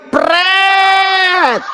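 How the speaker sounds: sharp high scoffing "Pret!" thrown at the name; mocking pret. Preacher scoffs at the borrowed church name, mocking. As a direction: scoff it out like a raspberry.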